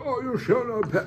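high, cut-off wizard proclamation; Gandalf line. Retold or imitated. Ian McKellen as Gandalf, breaking off before the stick. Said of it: "he's like".

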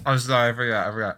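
talking while chewing; mouth full. Real, not acted. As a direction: say it through a mouthful of food. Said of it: "why are you eating".

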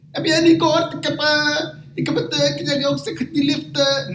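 very high, halting broken Dutch. Retold or imitated. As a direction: play the stammering delivery guy in broken Dutch. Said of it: "Oké, laat me zo praten". (heel hoog, haperend gebroken Nederlands)

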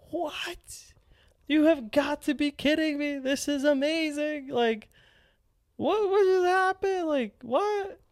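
high, stunned squeaky disbelief. Retold or imitated. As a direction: squeal it like you can't believe your luck. Amazed reaction retold in story.

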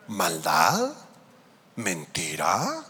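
"Maldad" and "Mentira" low and drawn out, each swooping up at the end; leading doubtful questions. Acted. Preacher asks congregation about the lamb, doubtful, "Maldad" and "Mentira" rising.

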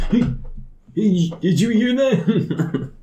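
high, slow, startled voice; high startled NPC. Acted. Startled man asks his friends, high and slow. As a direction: pitch it up, a bit spooked.

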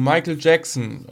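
ordinary delivery

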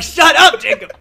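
high, loud shut up; yelling at Jacob to stop; annoyed shout. Retold or imitated. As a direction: snap at the friend loudly. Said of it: "I'm like".

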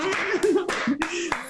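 high burst of laughter; cracking up high; real high laugh. Real, not acted. Burst of laughter after story.

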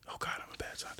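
hushed aside to yourself; very quiet whisper; whispered self-reproach. Real, not acted. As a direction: whisper it, half under your breath.

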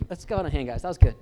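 loud praise over the crowd; cheering. Real, not acted. Speaker praises Alvin to the crowd, loud cheer. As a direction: cheer him on loudly.

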